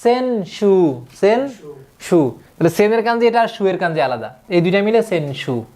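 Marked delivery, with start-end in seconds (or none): 0.0-2.4 সেন সু drawn out very slowly, syllable by syllable; teacher spelling out সেন সু slowly; slow syllable count. Shown as a demonstration. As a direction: stretch সেন সু slowly for the learner. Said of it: "কি হবে?".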